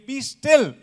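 high, loud ringing command; Jesus rebuking the waves with authority; commanding rebuke. Retold or imitated. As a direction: silence the waves like muzzling a barking dog. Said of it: "he said to the waves".

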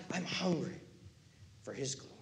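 trails off into a whisper; Jesus, hushed and fervent; fading to whisper. Retold or imitated. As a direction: let it fade to a hush. Jesus voiced, fades.

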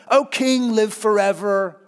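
oily courtier flattering the king; slimy flattery. Retold or imitated. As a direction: fawn over the king with "O king, live forever". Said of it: "it's kinda slimy".

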